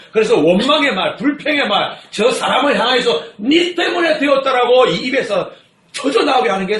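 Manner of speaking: loud, emphatic pulpit delivery; preaching. Acted. Pastor warns the congregation, forceful preaching.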